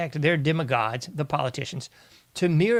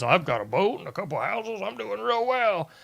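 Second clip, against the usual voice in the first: mock bragging voice, climbing higher; boastful somebody; 5 semitones higher than usual. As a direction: put on a self-satisfied boaster. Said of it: "'Cause I know somebody says".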